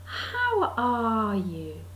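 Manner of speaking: slow "How ARE you", the middle word drawn out; stress on ARE. Retold or imitated. Meeting-goer's inevitable question, slow stress on ARE. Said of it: "emphasising the middle bit".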